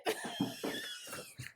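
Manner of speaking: screaming laugh. Real, not acted.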